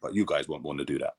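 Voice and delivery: low, soft deadpan put-down. Retold or imitated. Coach dismissing his players, deadpan. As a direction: imitate a coach dryly dismissing it. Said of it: "he's so deadpan".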